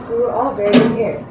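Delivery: normal talking voice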